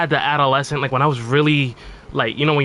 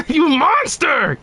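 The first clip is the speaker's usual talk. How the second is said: high, slow cry of outrage; mock outraged gamer; 12 semitones higher, much slower than usual. Streamer mimicking a player raging at his trolling, high slow wail. Quoting angry player reply.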